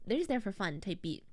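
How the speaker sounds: ordinary delivery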